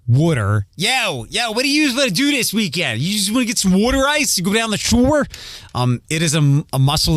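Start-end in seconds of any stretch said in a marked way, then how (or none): 0.1-0.6 "would'er" with a short 'wood' vowel; Philly 'water'. Shown as a demonstration. Host showing his old pronunciation, Philly "would'er". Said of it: "so that I don't say". 0.7-5.3 higher-pitched, hammed-up Philly accent. Shown as a demonstration. Host mimicking his old hometown talk, hammed-up Philly accent. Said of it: "so that I don't say".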